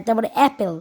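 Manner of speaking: usual speaking voice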